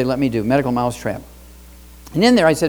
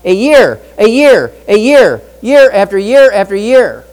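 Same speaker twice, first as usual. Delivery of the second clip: building emphasis; 4 semitones higher, 10 dB louder, slower than usual. Real, not acted.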